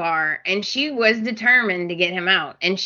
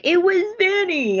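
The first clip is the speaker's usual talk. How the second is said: mock feeble Thomas; 11 semitones higher than usual. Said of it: "And he's like".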